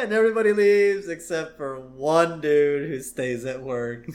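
normal talking voice

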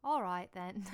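chummy Cockney impression; Michael Caine. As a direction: wrap up in a hammed-up London accent. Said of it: "It's like having Michael Caine".

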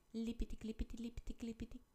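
high, light patter of hopping feet; sing-song hopping sound, bouncy; hopping footsteps. A narrator's reading. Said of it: "A nyúl jött arra, vidáman". (vidám lipiti-klipiti, magasan)

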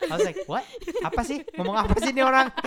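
high, laughing bafflement; high laughing what-is-he-saying confusion; baffled. Retold or imitated. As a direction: act totally lost and amused.

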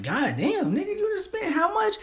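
high, shocked friend voice; shocked mock voice. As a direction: squeal it out in disbelief.

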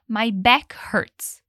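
very slow, word by word; slow model. Shown as a demonstration. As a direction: draw each word out for learners. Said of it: "o rapaz diz". (bem devagar, palavra por palavra)

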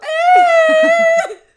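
big shrieking laugh that breaks out; loud burst of laughter. Real, not acted. Retelling awkward crying moment.

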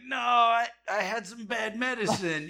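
put-on high-pitched drunken denial; re-enacted squeaky excuse; feeble excuse. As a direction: plead innocence in a high voice. Teenage excuse to screaming mother.

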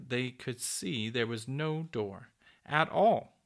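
ordinary delivery

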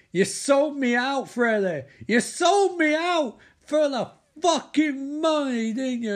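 high, loud, slow furious yell; angry accusation. Acted. Will accusing Freddie of selling him out, loud and furious. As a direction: hurl the betrayal at her.

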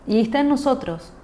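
normal talking voice

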